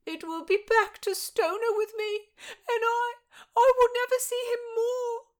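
high, loud, crying maid's voice; tearful lament. Acted. Maid weeps to her mistress over losing her love, crying. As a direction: sob out the lament.

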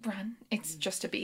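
hushed whisper; urgent hushed whisper to a panicking host. Acted. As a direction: whisper it so the bee won't stir.

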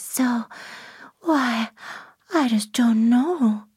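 high, breathy bedroom voice; slow, airy baby-voiced allure; Marilyn Monroe. Shown as a demonstration. Demonstrating the star's bedroom voice to the interviewer, high and breathy. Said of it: "she also is broadcasting a bedroom voice".

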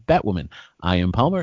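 ordinary talk

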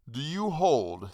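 lightly voiced hangman; challenging hangman. A narrator's reading. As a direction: draw it out as a challenge. Hangman challenges the man who protested.

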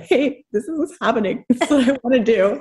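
laughing, a bit teary confession; emotional through laughter. Retold or imitated. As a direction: retell the tearful ask with a laugh. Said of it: "get all emotional".

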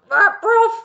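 loud, high mock bark; dog bark imitation. Podcaster acting out the dog joining in, loud high bark. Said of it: "and the dog goes".

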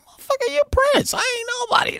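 very high, fast yelp; incredulous shout. Retold or imitated. Friend telling Prince he is the star, shouting high. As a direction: fire it back in disbelief.

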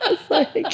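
high-pitched giggling fit; real high giggles trailing off. Real, not acted. Laughing at own story.

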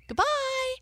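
high, loud chipmunk goodbye; chipmunk shouting goodbye. A narrator's reading. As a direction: squeak a loud farewell. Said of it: "long enough to shout".

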